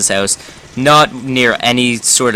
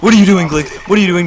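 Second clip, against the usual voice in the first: exasperated pleading; 6 semitones higher, much faster than usual. Retold or imitated. Friends plead with squatting friend.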